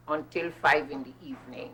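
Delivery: normal talking voice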